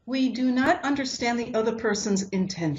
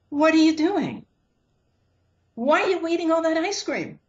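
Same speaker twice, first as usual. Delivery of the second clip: much higher, louder scolding call; loud public rebuke; 8 semitones higher than usual. Retold or imitated. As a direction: call her out across the party. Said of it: "he's saying it really loud".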